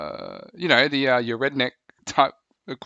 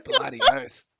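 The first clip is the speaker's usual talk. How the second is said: much higher, hammed-up Aussie cry; Aussie exclamation. Aussie bloke hyping his horse to mates, in a high hammed-up Australian accent. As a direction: squeal it like an over-the-top Aussie. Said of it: "Australian, New Zealand accent".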